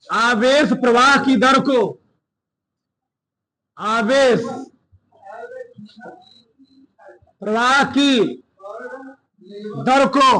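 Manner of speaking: measured classroom dictation, phrase by phrase; slow dictation. Real, not acted. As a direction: dictate slowly with long pauses for writing. (धीरे-धीरे रुक-रुककर इमला बोलते हुए)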